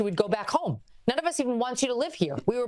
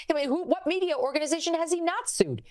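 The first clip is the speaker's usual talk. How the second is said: ordinary talk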